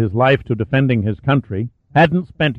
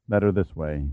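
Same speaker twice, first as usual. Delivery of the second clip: vague voice; 5 semitones lower, 7 dB quieter than usual. A narrator's reading. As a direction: lower and softer, absent-minded. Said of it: "his voice vague".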